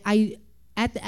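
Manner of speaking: ordinary delivery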